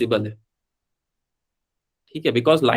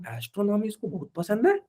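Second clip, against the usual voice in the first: soft, higher questioning echo, rising at the end; retold incredulous aside; 6 semitones higher, 7 dB quieter than usual. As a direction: echo the student's claim in quiet disbelief. Teacher's doubting aside about student. (हैरानी भरा धीमा सवाल, ऊँचे सुर में)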